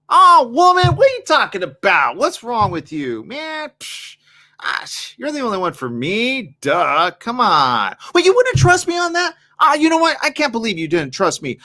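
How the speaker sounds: high, loud, mock-offended; deflecting man. Cheating man turning it on his partner, high and mock-indignant. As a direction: act wounded to dodge the question. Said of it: "men will offset it".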